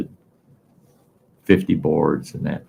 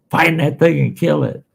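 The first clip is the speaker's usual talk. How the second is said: scared shout; 10 semitones higher, 4 dB louder than usual. Retold or imitated. Scared Chuck shouting from the hill.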